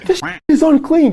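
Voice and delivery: high, loud, hammed-up angry yell; a red-faced homeowner ranting about grease, high and loud; furious client yelling. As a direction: screech it like a raging brute. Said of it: "And he's like, and he's like".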